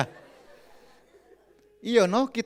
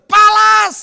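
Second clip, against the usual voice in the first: very high, loud yell; louder mock shout; 17 dB louder than usual. Wife yelling again even louder at husband, loud shout. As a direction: yell it even harder than before. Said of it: "Dia teriak lagi lebih keras".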